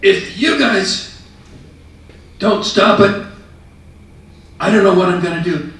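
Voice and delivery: loud, high, furious shout; red-faced store owner yelling at the kids. Retold or imitated. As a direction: yell it at the kids like an enraged shop owner. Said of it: "he was just furious".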